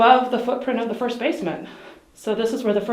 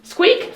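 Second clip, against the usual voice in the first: high squeak imitation; imitated squeak; 13 semitones higher than usual. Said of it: "The sound they capture is".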